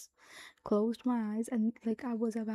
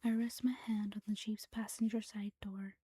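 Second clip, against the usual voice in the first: whispered story reading. Acted. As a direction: read the story in a hushed whisper.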